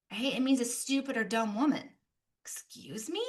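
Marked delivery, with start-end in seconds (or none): 2.4-3.2 whispered, mock outraged excuse me; mock offended. Speaker pretending a woman is insulted by the name, whispered. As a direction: feign being insulted.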